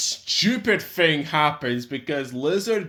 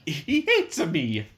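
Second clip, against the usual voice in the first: Mario voice; slower than usual. Retold or imitated. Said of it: "Sounds like Mario".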